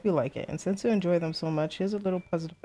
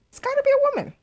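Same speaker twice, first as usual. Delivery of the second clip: very high, loud squeal; high-pitched insistence; 6 dB louder, faster than usual. Real, not acted. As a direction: insist on it, voice shooting way up. Repeats guess in squeaky high voice.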